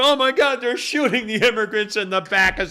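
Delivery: high, loud mock alarm; hammed-up shock at the news; mock horror. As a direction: gasp it out as if outraged.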